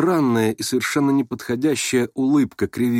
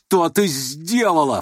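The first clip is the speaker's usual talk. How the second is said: slow, higher-pitched accusation; furious cry; 6 semitones higher, slower than usual. A narrator's reading. Ganya seizing his sister's arm, furious cry. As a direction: stretch out the outraged question. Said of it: "вскричал он".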